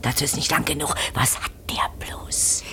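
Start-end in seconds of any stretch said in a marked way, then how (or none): 1.1-2.6 slow, hushed whisper. Acted. Old woman at the window whispering while she watches him. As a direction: breathe the question without voice. Spying on neighbour at night.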